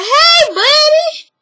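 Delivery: mock cheerful hey buddy; high sweet greeting. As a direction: chirp it like a fake-sweet greeting. Sisters greet and hug.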